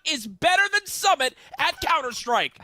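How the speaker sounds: caster call building to a screaming peak; esports caster. Pretend. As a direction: hype announcer erupting into a shout. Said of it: "You guys mind if I try something here".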